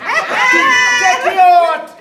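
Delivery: high, loud, drawn-out shout; shouted demand. Real, not acted. Player pressing a rival to take out the pen, shouted demand. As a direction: stretch it into a long, high demand.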